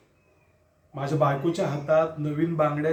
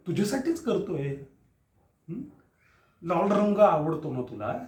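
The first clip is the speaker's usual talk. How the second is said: ordinary delivery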